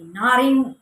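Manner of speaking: loud curt reply; harsh answer. Retold or imitated. Sister answering from the bathroom, harsh and loud. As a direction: throw the answer back sharply. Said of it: "उसकी आवाज़ बहुत भारी लग रही थी".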